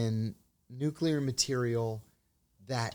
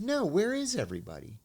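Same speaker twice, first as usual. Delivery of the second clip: higher, lightly voiced puzzled colleague; puzzled junior officer; 10 semitones higher, 3 dB louder than usual. Junior officer puzzled by the empty cafeteria, voiced higher. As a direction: ask it like a clueless young officer, pitched up. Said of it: "And she said".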